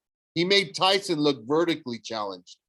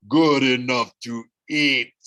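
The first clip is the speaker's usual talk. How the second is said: leering monster; 6 dB louder than usual. Acted. Cyclops leering.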